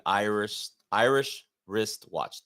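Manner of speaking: Irish wristwatch said slowly, word by word; careful over-deliberate tongue twister; deliberate slow take. Shown as a demonstration. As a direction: spell out each sound of Irish wristwatch on purpose. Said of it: "Don't say it intentionally".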